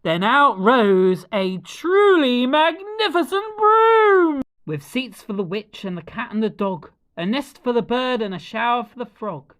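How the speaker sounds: ordinary delivery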